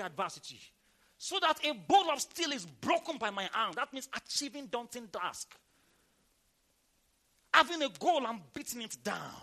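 loud, emphatic declarations in bursts; fired-up preaching. Real, not acted. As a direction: proclaim each blessing forcefully to the congregation. Preaching at full force.

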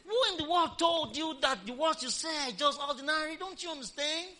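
preacher scolding the congregation, indignant. Acted. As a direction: rouse them, scold the crowd. Preacher rebukes posh talk.

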